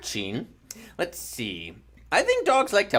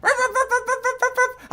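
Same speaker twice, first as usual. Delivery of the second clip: pretend dog barks. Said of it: "You wanna do that with me?".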